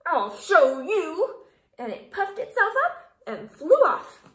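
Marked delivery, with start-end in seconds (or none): 0.1-1.4 slow, loud threat with a laugh. A narrator's reading. Grouchy ladybug threatening as it flies off, slow and loud. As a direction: promise revenge, hammed-up.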